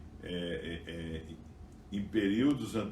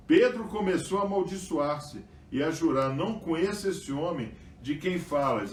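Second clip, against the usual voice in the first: scripture reading; 7 semitones higher, 4 dB louder than usual. Acted. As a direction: read the passage out to the congregation. Reads the scripture passage aloud. (leitura bíblica em voz alta e elevada)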